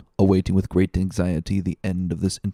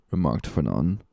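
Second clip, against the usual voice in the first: ordinary delivery, nothing marked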